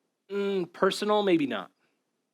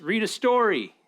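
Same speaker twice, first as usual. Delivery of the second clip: a loud, higher barked order to the device; yelled command; 8 semitones higher, 4 dB louder than usual. Retold or imitated. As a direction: shout the command at the speaker. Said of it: "Polly's yelling at Alexa".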